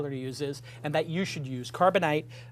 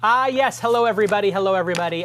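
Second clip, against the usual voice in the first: big welcome; 4 semitones higher, 8 dB louder than usual. Real, not acted. Host greeting viewers loudly.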